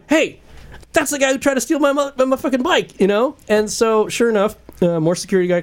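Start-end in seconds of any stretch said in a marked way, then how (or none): 0.1-3.0 high-pitched yell across a street; retold urgent shout, pitched way up; acted alarmed callout. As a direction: call out the thief to security. Retelling calling out thief to security.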